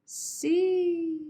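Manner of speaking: long high sustained "see"; high pitch. Shown as a demonstration. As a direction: send SEE up high and hold it. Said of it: "go high in pitch there".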